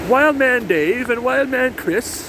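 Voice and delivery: high, slow, grand explorer proclamation; Chris Cass impression. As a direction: proclaim it like a pompous colonial explorer. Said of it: "as Chris Cass would say".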